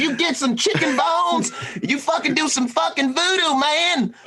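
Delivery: very high, drawn-out caricature of a pal; Marcus impression. Cohost mimics his friend offering voodoo, hammed-up high impression. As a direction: crank the pitch way up to mimic your buddy. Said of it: "that's exactly how Marcus sounds".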